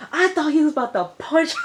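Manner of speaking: high, loud, quick outburst; animated disbelief. Real, not acted. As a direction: burst out high and fast, about to laugh. Cracking up at her own reaction.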